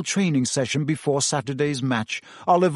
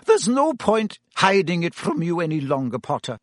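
lightly voiced stern professor, grave; a hint of a strict Scottish teacher breaking bad news; very serious; 5 semitones higher than usual. A narrator's reading. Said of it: "she said in a very serious voice".

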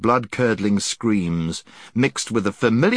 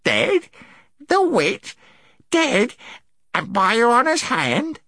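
very high, incredulous creature voice; high-pitched amazement; astonished gnome; slower than usual. A narrator's reading. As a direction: squeak each question in disbelief. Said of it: "wider and wider in astonishment".

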